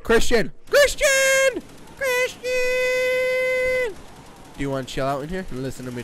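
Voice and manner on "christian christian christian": calling out. Real, not acted. Calling a brother in the house, escalating yell. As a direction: shout building to a high, long scream.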